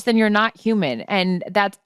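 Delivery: usual speaking voice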